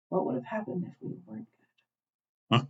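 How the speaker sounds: put-on small, high, hushed child's voice; little girl. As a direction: softly imitate a little girl asking her brother. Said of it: "the little girl, who hasn't said a damn thing".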